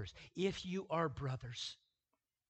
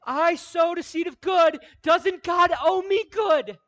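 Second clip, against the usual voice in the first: much higher, much louder shrieking tyrant; screaming inner tyrant; 19 dB louder than usual. Acted. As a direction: shriek it like a spoiled little tyrant. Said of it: "rises up within you ... and screams".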